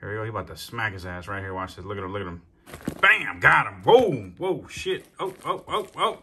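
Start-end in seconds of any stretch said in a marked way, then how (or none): 3.0-6.2 sudden loud burst of excitement; excited shout. Real, not acted. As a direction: yell out as the strike lands. Snake strikes the rat live.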